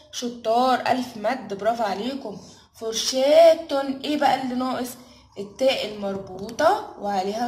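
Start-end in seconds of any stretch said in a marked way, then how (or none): 2.8-4.0 very slow, louder, each syllable drawn out; syllable-by-syllable stretch. Shown as a demonstration. Teacher to kids, sounding out a word, slow and stretched. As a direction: sound the word out slowly for small children. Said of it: "فر شا".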